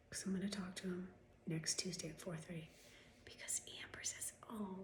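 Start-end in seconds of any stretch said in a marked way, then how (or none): 4.5-4.8 soft, raised, syrupy receptionist; mocking, dripping helpfulness; fake receptionist voice. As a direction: imitate a phony-sweet phone manner. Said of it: "That voice is fake".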